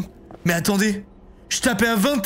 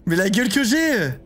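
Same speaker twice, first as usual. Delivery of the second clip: ordinary talk, nothing marked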